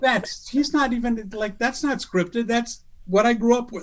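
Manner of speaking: high, slow, amazed, almost reverent; awed disbelief. Retold or imitated. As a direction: gush like a starstruck kid. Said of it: "I'm like".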